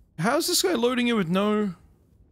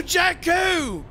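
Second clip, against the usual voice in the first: calling out; 11 semitones higher than usual. Acted. Squad leader calling out to silent teammate, shouted. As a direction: yell Jakku across the battlefield radio.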